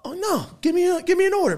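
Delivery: very high, excited plea; eager buyer. Retold or imitated. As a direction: beg for an order, thrilled.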